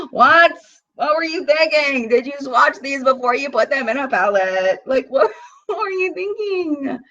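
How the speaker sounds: high, laughing mock outrage at the product; squeaky exasperated disbelief; incredulous scolding. Real, not acted. Mock scolding the palette brand.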